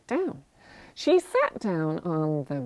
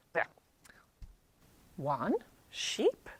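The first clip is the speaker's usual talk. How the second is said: plain reading voice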